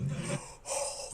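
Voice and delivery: big theatrical gasp; mock shocked gasp. Host feigns horror at the comedian's joke, mock shocked gasp.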